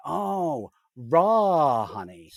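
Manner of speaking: drawn-out American "raw"; mock American accent. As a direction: imitate Americans, stretching "raw" into a long "rah". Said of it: "And they went".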